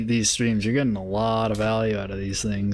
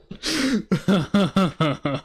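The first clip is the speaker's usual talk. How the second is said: laughing; 7 semitones higher than usual. Real, not acted. Laughing at chart.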